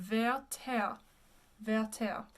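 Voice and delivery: Werther as "veh-tehr", open e; French pronunciation of Werther. Shown as a demonstration. Teacher repeats after explaining the vowels, French pronunciation. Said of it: "So you get".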